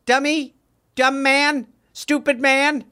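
usual speaking voice

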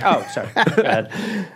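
normal talking voice

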